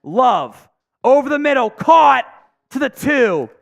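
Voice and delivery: rising play-by-play, peaking on caught; live play-by-play. Acted. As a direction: call it like the broadcast booth.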